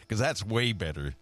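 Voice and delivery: ordinary delivery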